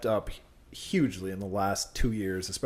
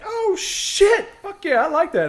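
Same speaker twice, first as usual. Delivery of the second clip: much higher, louder mock excitement; hyped reaction; 7 dB louder, slower than usual. Imitating a stranger thrilled by Lady Liberty, much higher. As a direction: imitate the guy's thrilled outburst. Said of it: "And he was just like".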